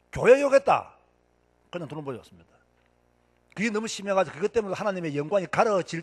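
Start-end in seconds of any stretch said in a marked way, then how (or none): 0.1-0.9 높고 크게 내지르는 한마디; 목소리를 확 높여 강조; 강조해서 외치기. Shown as a demonstration. 설교자가 욕하는 상황을 강조. (높고 크게 강조하는 외침)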